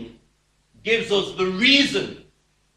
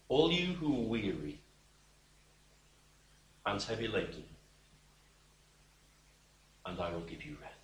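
much softer, fading to a hushed, gentle call; tender invitation; 9 semitones lower, 10 dB quieter, faster than usual. Acted. Preacher voicing Christ inviting the weary, hushed and tender. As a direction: offer rest to the weary like a soft promise.

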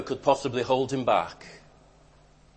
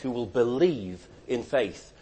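ordinary talk

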